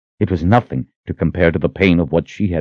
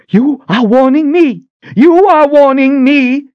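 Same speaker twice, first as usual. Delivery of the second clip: much higher, louder shrieking scorn; furious disbelief; 6 dB louder than usual. Acted. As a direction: fling his words back, enraged. Sister mocks his warning in rage.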